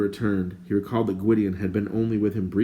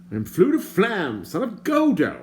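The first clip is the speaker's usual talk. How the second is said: higher, prim and indignant stranger; lightly voiced fussy stranger; 11 semitones higher, 5 dB louder than usual. A narrator's reading. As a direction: play the fussy bard, put out. The bard's voice.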